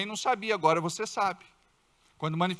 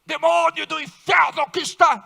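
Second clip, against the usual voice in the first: high, loud, in-your-face shout; rebuking shout; 8 semitones higher, 8 dB louder than usual. Shown as a demonstration. Believer shouting rebuke at a person's face, loud yell. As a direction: yell at the demon openly. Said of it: "Quantas aqui quase apanharam?".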